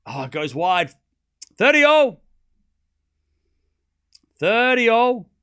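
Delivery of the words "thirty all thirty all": loud, high umpire-style score call, said twice. Pretend.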